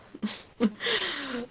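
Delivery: a light, easy chuckle; amused chuckle at a friend's idea. Acted. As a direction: chuckle at the thought. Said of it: "starts to chuckle".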